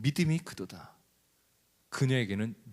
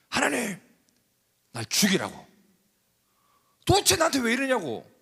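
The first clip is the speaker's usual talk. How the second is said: much higher, louder furious cry; Jonah's angry outburst; 10 semitones higher, 5 dB louder than usual. Acted. Jonah shouting at God in fury, high and loud. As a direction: rage at God, begging to die. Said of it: "이렇게 하죠".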